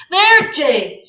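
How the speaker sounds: high, loud exclamation; sharp outburst. Acted. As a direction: fling it out sharply.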